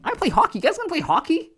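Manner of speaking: high, loud incredulous squeal; mock surprise. Retelling his own shock to the kids, hammed-up high disbelief. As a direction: act baffled that they don't play hockey. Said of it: "I'm like".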